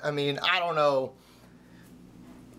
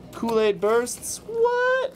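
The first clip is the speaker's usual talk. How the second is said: high, slow, louder product name; excited; 8 semitones higher, 3 dB louder, much slower than usual. Real, not acted. Showing Kool-Aid Bursts to viewers, high excited gush. Excited about old drink.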